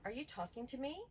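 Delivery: mild, even question with a rising end; calm question. Shown as a demonstration. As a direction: ask it calmly, no edge. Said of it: "So one of them would be".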